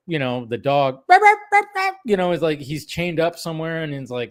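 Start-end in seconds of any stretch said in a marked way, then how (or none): 1.1-2.0 high, loud yapping dog cries; dog yelp imitation. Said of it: "you hear the dog do the".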